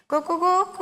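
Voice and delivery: high crow with a long held ending; drawn-out rooster crow. Shown as a demonstration. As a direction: crow it long for the class to copy. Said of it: "അതുപോലെ നീട്ടി എല്ലാവരും ഒന്ന് ഉച്ചത്തിൽ വിളിച്ചേ".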